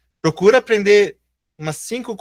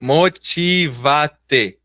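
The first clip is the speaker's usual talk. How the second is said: motivate esticado, muito devagar; pronúncia bem lenta; much slower than usual. Shown as a demonstration. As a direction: arraste cada sílaba de motivate. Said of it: "professor demonstra pronúncia". (motivate dito bem devagar)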